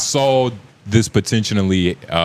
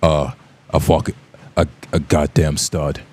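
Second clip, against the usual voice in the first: Jason Statham impression; 5 semitones lower than usual. Jason Statham impression, a mock tough guy. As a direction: growl a goddamn stud like a British action hero. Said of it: "that's how Jason Statham sounds".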